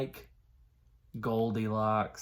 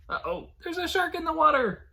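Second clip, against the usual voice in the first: high, startled warning; alarmed; 4 dB louder than usual. Acted. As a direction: gasp it out to the kids. Alarmed aside to kids.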